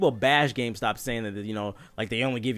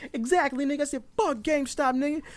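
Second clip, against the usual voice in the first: high-pitched indignant agreement; retold venting, pitched up; mad; 13 semitones higher than usual. Customer venting to his friend about GameStop, mad. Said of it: "being mad as fuck".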